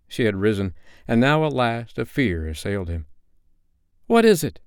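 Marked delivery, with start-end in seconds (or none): 4.1-4.6 high, loud, shrinking question; frightened boy's high outburst; alarmed cry. A narrator's reading. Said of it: "he cried, shrinking".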